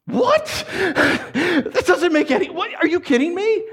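high, laughing what-are-you-kidding-me; high laughing incredulity; laughing disbelief. Acted. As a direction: squeal in mock disbelief through a laugh.

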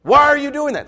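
husband sharply scolding the taunting wife; firm rebuke. Retold or imitated. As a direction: put your foot down and stop her. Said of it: "That's what he should do".

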